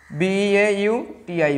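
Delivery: slow, letter by letter; spelling out. Shown as a demonstration. Teacher spelling beautiful for the class, slow spelling out. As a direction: spell it slowly for students.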